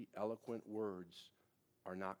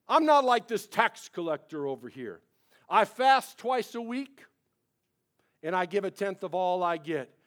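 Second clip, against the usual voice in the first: louder, higher, smug prayer; hammed-up Pharisee voice; 9 semitones higher, 20 dB louder than usual. Preacher mocking the Pharisee's boastful prayer, loud. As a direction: preen like a self-righteous man in church. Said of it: "So that was the Pharisee praying".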